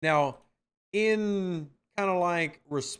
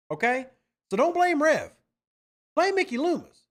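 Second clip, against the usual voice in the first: heated rant; 7 semitones higher than usual. Real, not acted. Host snapping back at chat over Traquan, raised fired-up voice. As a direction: push it up and out, defending himself.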